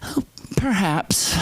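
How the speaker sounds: put-on slow, high, whispery old-mother voice; high, airy mock reluctance; passive aggressive. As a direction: imitate mom grudgingly accepting help, drawn out. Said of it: "very passive aggressive".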